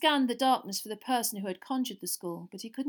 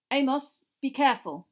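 slightly raised, louder caution; warningly; 4 semitones higher, 6 dB louder than usual. A narrator's reading. Wizards cautioning Amos, warning tone. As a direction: warn a friend off danger. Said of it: "said a few of the wizards warningly".

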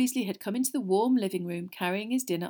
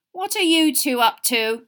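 loud, higher, sharp questioning; 7 semitones higher, 9 dB louder than usual. A narrator's reading. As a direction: demand an answer sharply from the twins. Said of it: "said Missus Weasley sharply".

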